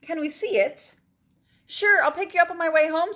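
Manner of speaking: calling out. Acted. Children calling to each other.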